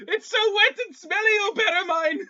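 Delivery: high, loud pleading wail; cry for help. Acted. Voice in Nox's mind begging for help, high loud mock wail. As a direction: call out desperately, hammed-up and high. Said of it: "in the back of your mind, you hear".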